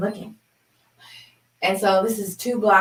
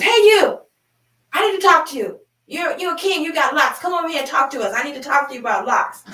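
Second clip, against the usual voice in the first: high, loud call to a passer-by; 9 semitones higher, 6 dB louder than usual. Retold or imitated. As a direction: holler him over, insistent and pitched up. Neighbor calls a man over.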